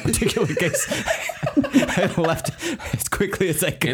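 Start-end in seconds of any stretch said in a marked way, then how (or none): 0.9-3.9 high, laughing through the words. Real, not acted. Laughing at own embarrassment.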